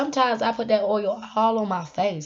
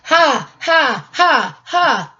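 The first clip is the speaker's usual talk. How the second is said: mock laugh; 3 semitones higher, 4 dB louder, much slower than usual.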